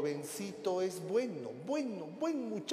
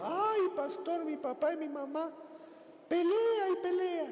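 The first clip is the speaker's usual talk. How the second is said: high, whiny complaint; wife whining about her parents, high pitched; 11 semitones higher than usual. Retold or imitated. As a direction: complain to the pastor like a girl. Wife tells pastor about parents.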